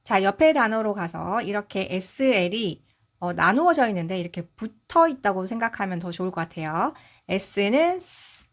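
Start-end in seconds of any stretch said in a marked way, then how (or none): none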